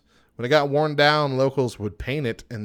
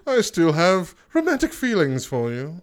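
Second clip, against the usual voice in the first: squeaky high, fancy put-on voice with laughs; mock-refined suitor laughing through it; posh mock accent; 10 semitones higher, 4 dB louder than usual. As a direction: ham up a snooty gentleman declaring love. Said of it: "southern accents".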